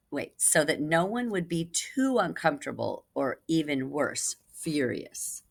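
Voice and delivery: ordinary delivery, nothing marked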